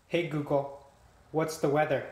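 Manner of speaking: ordinary talk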